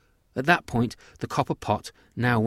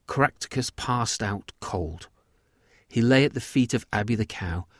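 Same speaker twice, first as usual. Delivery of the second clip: usual speaking voice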